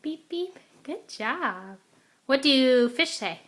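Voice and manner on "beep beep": car beep imitation. Echoing child's car sound.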